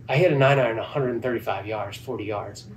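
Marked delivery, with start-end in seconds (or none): none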